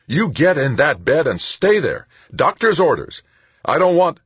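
burly medic angrily confronting the patient; angry medic. A narrator's reading. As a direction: bark at the patient out of bed. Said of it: "She was clearly angry".

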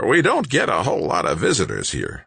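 high sandpaper rasp; rasping old man. A narrator's reading. Old man greeting a rare visitor, rasping. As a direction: rasp it out like a scrawny old-timer. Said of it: "rasped the old man".

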